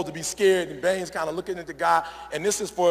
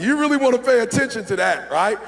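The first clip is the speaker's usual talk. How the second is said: teasing; 6 semitones higher, 5 dB louder than usual. Retold or imitated. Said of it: "You're like".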